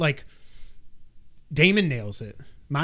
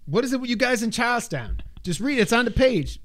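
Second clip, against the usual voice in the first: high, pitched-up acted complaint; exasperated character; 9 semitones higher than usual. Quoting a film scene, high exasperated voice. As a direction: act out impatience, high voice.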